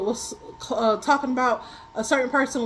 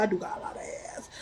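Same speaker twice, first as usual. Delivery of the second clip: laughing; 5 semitones lower, 10 dB quieter than usual. Real, not acted. Laughing at herself.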